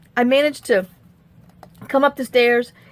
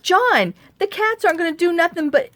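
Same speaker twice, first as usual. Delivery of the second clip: exasperated; 7 semitones higher than usual. Retold or imitated. Exasperated reply to husband.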